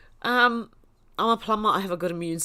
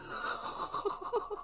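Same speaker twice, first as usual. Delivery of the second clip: breathless giggle; 9 dB quieter than usual. Real, not acted. Reaction to a cheeky comment.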